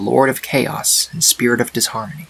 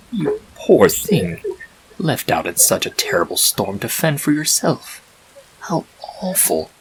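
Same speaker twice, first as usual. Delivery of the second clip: calm disembodied voice; 3 semitones higher than usual. A narrator's reading. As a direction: soothe the lost foal from nowhere. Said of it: "spoke calmly all around her".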